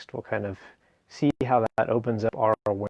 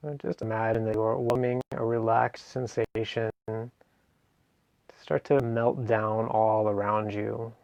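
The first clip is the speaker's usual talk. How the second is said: guided meditation. Acted. Meditation teacher guiding listeners through a seated relaxation, guided meditation. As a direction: ease them gently through each phrase, leaving space between.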